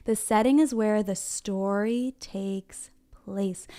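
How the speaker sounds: drawn-out, measured teaching pace; slow choral repeat. Shown as a demonstration. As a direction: stretch each word out so children can join in.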